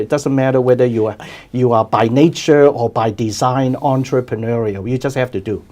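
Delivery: ordinary talk, nothing marked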